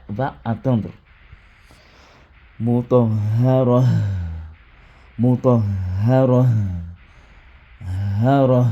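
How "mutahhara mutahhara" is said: slow, louder "Mutahhara" ending on an open ra; stop form. Shown as a demonstration. Teacher models the pause ending for listeners, slow and loud. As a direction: stress and stretch the final "ra". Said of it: "voilà ce que celui qui écoute".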